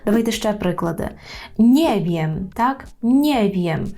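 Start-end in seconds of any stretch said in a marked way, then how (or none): 1.6-2.5 "Nie wiem" as one word, NIE stressed. Shown as a demonstration. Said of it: "і наголошуємо". 3.0-3.9 "Nie wiem" as one word, NIE stressed, falling. Shown as a demonstration. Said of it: "і наголошуємо".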